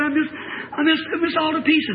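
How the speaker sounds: put-on high, distraught girl's voice; imitated wailing. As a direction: falter like a girl in pieces. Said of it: "she's just crying like that".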